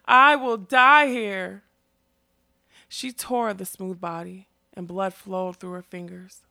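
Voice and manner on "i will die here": slow, much louder and higher wail; anguished wail. A narrator's reading. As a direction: drag each word out in despair. Girl tearing at her new body.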